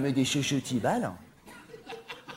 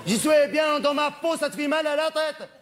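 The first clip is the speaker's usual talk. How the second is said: defiant shout; 9 semitones higher, 4 dB louder than usual. Acted. Defiant retort to audience.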